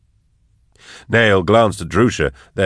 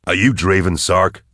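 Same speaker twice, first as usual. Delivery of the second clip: stern. A narrator's reading. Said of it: "Nayl asked sternly".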